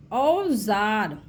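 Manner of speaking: teacher stretching औजार; drawn-out demo word. Shown as a demonstration. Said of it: "औ का sound देखिए".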